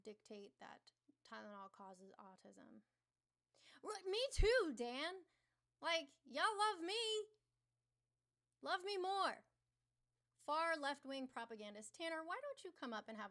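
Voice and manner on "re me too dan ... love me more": high, loud whiny begging for love; mock needy. Streamer teasing chat, loudly begging them to love her. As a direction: beg the chat for love, hammed up.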